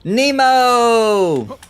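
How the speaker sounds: high, loud long call of a name. Real, not acted. Streamer calling to a friend in game, loud call. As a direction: hail a friend across the field.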